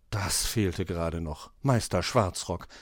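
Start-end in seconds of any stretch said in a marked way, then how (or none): none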